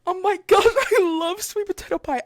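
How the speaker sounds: high, loud burst of delight; excited gushing. Retold or imitated. Cashier hearing a customer will make sweet potato pie, excited gushing. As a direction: gush with a laugh breaking in.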